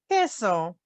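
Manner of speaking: usual speaking voice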